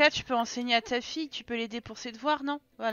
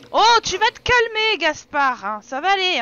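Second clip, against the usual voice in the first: loud soothing; 6 semitones higher, 10 dB louder than usual. Real, not acted. Calming an angry Sim.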